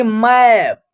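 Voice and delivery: "मैप" with a stretched open 'ae' vowel; teacher's model "मैप", A as a long 'ae'; open 'ai' sound. Shown as a demonstration. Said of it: "ऐ की मात्रा का प्रयोग".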